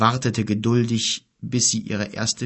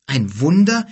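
indignant; 5 semitones higher, 7 dB louder than usual. A narrator's reading. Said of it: "empörte sich Kim".

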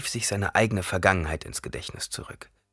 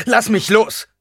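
high, loud, cold command; loud, hostile demand; icy anger; 14 semitones higher, 10 dB louder, slower than usual. A narrator's reading. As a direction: order her off sharply. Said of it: "Die eisige Kälte in seiner Stimme".

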